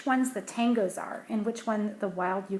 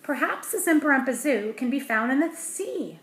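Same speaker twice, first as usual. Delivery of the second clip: professor's thought; 4 semitones higher, 6 dB louder than usual. Acted.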